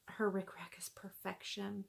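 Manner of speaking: admiring whisper. Real, not acted. Vlogger admiring the rickrack, admiring whisper. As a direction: hush it out in admiration.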